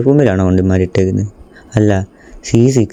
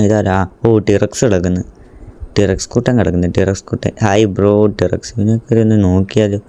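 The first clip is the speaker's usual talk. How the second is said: ordinary talk, nothing marked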